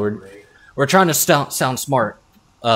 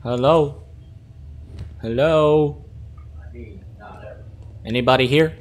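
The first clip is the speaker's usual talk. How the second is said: calling down a hallway; 4 semitones higher than usual. Real, not acted. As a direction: call out to an empty building. Calling into an empty base.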